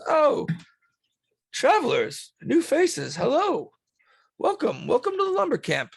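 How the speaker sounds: high-pitched, eager host voice; excited high hello to travelers; welcoming camp head. Acted. Stanley greets the newcomers.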